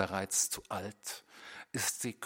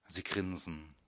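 ordinary delivery

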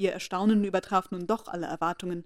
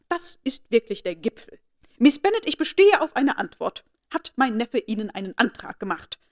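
haughty aunt demanding the truth; insistent anger; 3 semitones higher, 7 dB louder than usual. A narrator's reading. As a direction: insist on an answer. Lady Catherine insists.

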